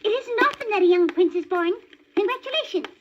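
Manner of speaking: bright high bird chatter; magpie voice. A narrator's reading. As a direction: play the chirpy magpie. Magpie congratulates newborn prince.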